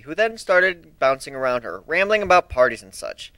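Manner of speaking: plain reading voice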